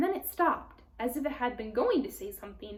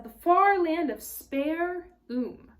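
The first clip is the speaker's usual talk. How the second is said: imitating Tumnus; 6 semitones higher, 7 dB louder, slower than usual. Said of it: "He said".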